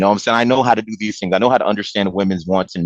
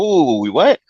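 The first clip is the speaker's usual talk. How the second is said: mock surprise; 6 semitones higher, 3 dB louder, slower than usual. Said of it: "I'm like".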